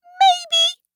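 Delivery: very high mouse squeak; tiny voice. A narrator's reading. Mouse admits hunting from the hall, tiny high voice. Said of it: "came a tiny voice".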